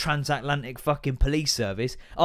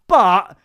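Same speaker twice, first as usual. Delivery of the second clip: loud, drawn-out "But"; pointed BUT; 3 semitones higher, 10 dB louder than usual. Acted. Mimicking the expected caveat, "But" loud and held. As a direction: hit "But" hard and hang on it. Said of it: "you think, I'm gonna go".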